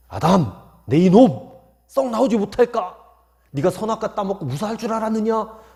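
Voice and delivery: high, loud booming scold; thundering rebuke. Pretend. Preacher voicing an angry God scolding Adam, thundering rebuke. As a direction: roar at Adam like an angry judge. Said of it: "그렇게 막 호통치는 음성으로".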